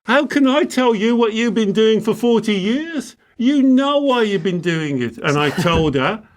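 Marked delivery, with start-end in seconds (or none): 0.0-5.2 1 exasperated inner thought. Retold or imitated. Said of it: "I thought".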